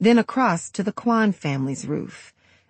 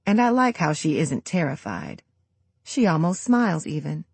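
plain reading voice

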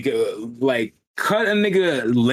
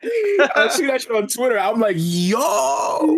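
hyped; 11 semitones higher, slower than usual. Retold or imitated. Hyped reaction to Twitter clip.

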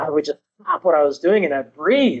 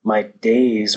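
normal talking voice

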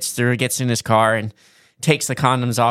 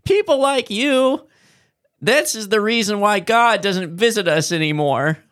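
professor voice; 9 semitones higher than usual. Retold or imitated. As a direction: lecture her in a high whine. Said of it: "he's like".

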